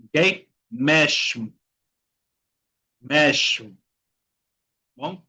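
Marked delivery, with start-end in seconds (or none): 0.7-3.8 "Mesmo" said meshmo, Rio style. Shown as a demonstration. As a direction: drill "Mesmo" with a hushing sh before the M. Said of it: "pueden hacer sonido de sh". ("Mesmo" con sonido sh, como en Río)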